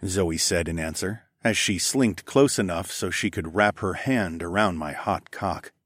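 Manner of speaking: plain reading voice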